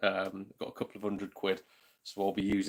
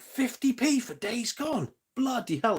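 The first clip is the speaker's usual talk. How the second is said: amazed; 13 semitones higher, slower than usual. Real, not acted. Amazed at cheap game.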